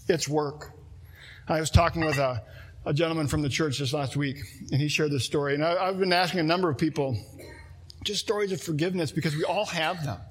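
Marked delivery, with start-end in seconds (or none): none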